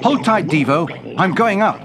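high, urgent call to a friend; urgent submarine. Acted. Submarine urgently starts the escape.

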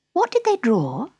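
high-pitched character question; curious Alice. A narrator's reading. Alice questioning the Dormouse, curious.

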